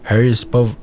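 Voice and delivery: ordinary delivery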